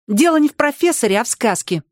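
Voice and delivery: high-pitched, possessive little objection; a child jealously correcting a grown-up. A narrator's reading. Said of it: "ревниво сказала Поля".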